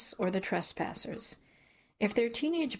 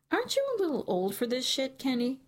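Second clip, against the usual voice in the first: higher, quick needling jab; teasing disbelief; 6 semitones higher than usual. A narrator's reading. Narrator ribs grown-up Kenny caught trespassing, quick and teasing. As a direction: rib him for acting like a kid.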